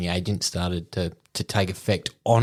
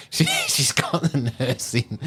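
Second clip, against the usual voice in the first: laughing; 5 semitones higher, slower than usual. Real, not acted. Laughing while retelling.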